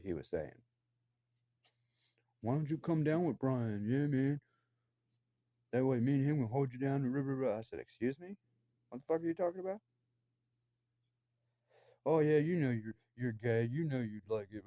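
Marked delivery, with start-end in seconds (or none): 2.4-7.6 pitched higher, a hint of a sneering taunt; mocking Eric's taunts. Imitating Eric taunting him over the phone, mocking sneer. 7.8-9.8 higher, incredulous retort; shocked disbelief. Retold or imitated. He retells answering Eric's vile taunt, incredulous. 12.0-14.7 higher and louder, a jeering leer; mocking Eric's taunt. Imitating Eric's crude phone taunt, hammed-up jeer.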